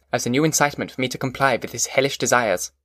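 ordinary delivery, nothing marked

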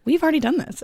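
surprised. Retold or imitated. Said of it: "I looked at Matt and I said".

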